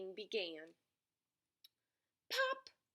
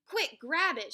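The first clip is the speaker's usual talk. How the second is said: loud, higher urgent order; shouting; 6 semitones higher, 8 dB louder than usual. A narrator's reading. Rabbit spotting the nut pop up, shouting. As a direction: shout for quick action. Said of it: "shouted Littlest Rabbit".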